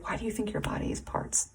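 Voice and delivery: soft, breathy goddess whisper; whispered question. Acted. Hecate quietly questioning the viewer, whispered. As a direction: ask it gently under the breath. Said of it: "she's like".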